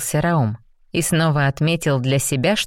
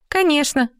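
high snort of scorn; 11 semitones higher than usual. Acted. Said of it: "фыркнула девушка".

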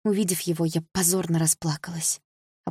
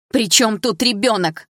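loud, slow exclaim; 5 semitones higher, 6 dB louder, slower than usual. A narrator's reading. Daughter exclaiming at her mother, angry. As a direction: demand an answer. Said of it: "воскликнула я".

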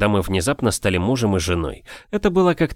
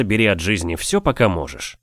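usual speaking voice

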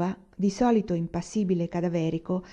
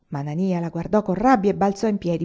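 ordinary delivery

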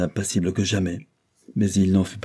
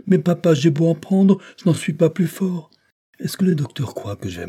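put-on high, louder little boy voice; young William, protesting; 9 semitones higher, 6 dB louder than usual. Sick son objects to his father about the medicine, childlike protest. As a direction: protest like a small child, pitched well up.

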